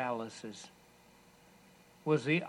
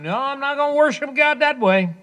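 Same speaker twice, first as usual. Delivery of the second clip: defiant refuser voice; 13 semitones higher, 12 dB louder than usual. Retold or imitated. Mocking a refuser's voice.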